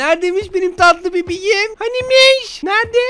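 high sing-song cooing; mock-sweet babysitter coo; baby talk.

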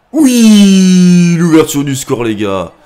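huge high yell, easing down as it goes; goal celebration shout. Real, not acted. Gamer scoring the opening goal, jubilant loud shout. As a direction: explode with real joy at the goal.

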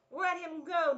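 high, loud bunny demand. A narrator's reading. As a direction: stand up to the bear. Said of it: "demanded Dot".

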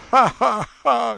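high, loud mocking ha-ha-ha; shouted villain's laugh. A narrator's reading. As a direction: laugh out brutally, like a tyrant. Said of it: "shouted the brutal Leonardo".

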